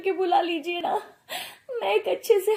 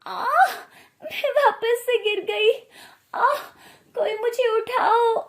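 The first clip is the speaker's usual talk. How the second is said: hammed-up cries of pain, higher and slow; faked pain; 4 semitones higher, slower than usual. Bride fakes a fall to trick her mother-in-law, hammed-up groans. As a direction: pretend you've fallen and can't get up.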